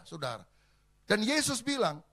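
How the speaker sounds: normal talking voice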